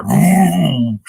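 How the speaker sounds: loud rising "vroom" truck noise; engine roar. Retold or imitated. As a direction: imitate a revving truck, loud and high. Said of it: "truck going and you hear".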